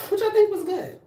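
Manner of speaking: squeaky high proud aside; high-pitched pride. Real, not acted. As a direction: pipe it up high, pleased with yourself. Proud of four hours.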